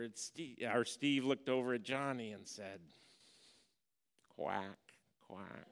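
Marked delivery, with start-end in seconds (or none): 4.3-5.7 soft, sly duck quacks, quieter than his talk; taunting quack. Retold or imitated. Brother needling Johnny with a sly soft quack. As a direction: quack it under your breath to needle him.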